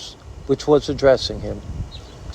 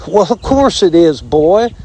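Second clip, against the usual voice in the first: a touch of jollity; mirth; 5 semitones higher, 4 dB louder than usual. A narrator's reading. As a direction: tease with good-natured mirth. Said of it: "said the armadillo with mirth".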